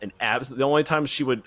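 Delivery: ordinary talk, nothing marked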